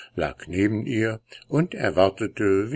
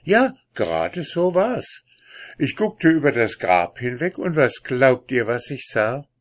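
excited storyteller; 6 semitones higher, 4 dB louder than usual. Acted. Gravedigger tells tale excitedly.

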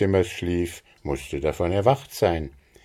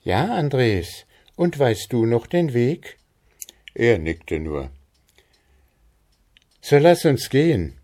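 put-on higher girlish voice, brisk; ready to set off; lightly voiced Maren; 6 semitones higher than usual. A narrator's reading. Maren answers Andres and urges him on, higher girlish voice.

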